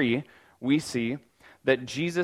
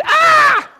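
high shrieking cartoon yelp; a hammed-up freak-out scream; mock panic scream; 14 dB louder than usual. God pictured freaking out, a high hammed-up scream. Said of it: "God never goes".

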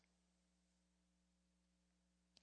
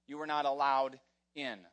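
ordinary talk, nothing marked

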